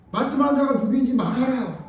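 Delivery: loud, high retold demand; acted demand. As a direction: call it out as an acted order. Voicing Peter's demand to John.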